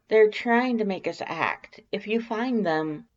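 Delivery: high, urgent, stammering; nervous Alphys voice. A narrator's reading. Alphys warns Sans of the trap, high nervous plea. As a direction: push the warning out, high and anxious.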